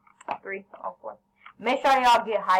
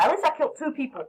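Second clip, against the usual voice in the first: laughing; 5 semitones higher, faster than usual. Real, not acted. Defends himself laughing.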